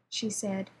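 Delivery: ordinary delivery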